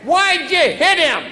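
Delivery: dad calling from another room; slow, loud call through the house. Retold or imitated. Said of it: "my dad from the other room".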